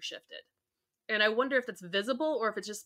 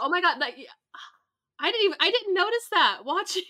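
amazed disbelief; 7 semitones higher than usual. Real, not acted. Amazed she missed the casting.

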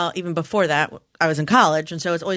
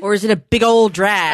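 loud, high mocking question; 4 semitones higher, 10 dB louder than usual. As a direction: sneer at the drag.

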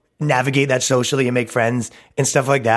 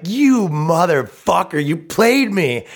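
higher, slow drawn-out accusation; mock indignation; 6 semitones higher, 3 dB louder, much slower than usual. Comic confronting the friend who sent mass messages, mock indignation. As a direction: drag out each word like a betrayed friend. Acting out being played by friend.

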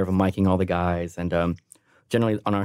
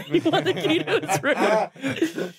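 high, slow voice breaking into laughter; cracking up in a squeaky mock voice; laughing mimicry; slower than usual. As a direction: repeat it mockingly through laughs. Laughs while repeating the mimicked line.

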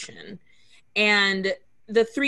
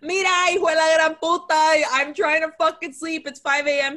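higher and louder, exasperated cursing; mock angry yell; 6 semitones higher, 4 dB louder than usual. Retelling the husband yelling at the neighbor at 5 am, higher, louder, mock angry. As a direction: yell at the neighbor through the door. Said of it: "he's like".

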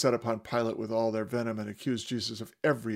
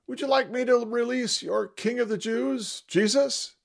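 much higher, raised voice to a crowd; Pilate calling to crowd; 12 semitones higher, 6 dB louder than usual. Acted. Pilate calling to the crowd about Jesus. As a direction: call out to the mob, half taunting. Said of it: "Pilate queried the crowd".

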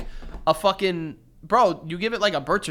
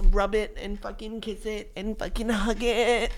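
mock ritual coaxing; 2 semitones higher, 5 dB quieter than usual. Imitating store staff guiding the heart ritual, mock coaxing. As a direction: coo it in a mocking singsong.

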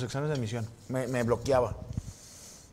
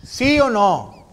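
high, loud, drawn-out "¿Sí o no?"; slow playful dad challenge; teasing dad; 8 semitones higher, 13 dB louder, much slower than usual. Retold or imitated. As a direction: tease him slowly, loud and high. Dad teasing about result.

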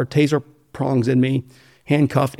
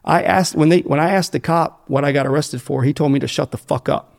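ordinary delivery

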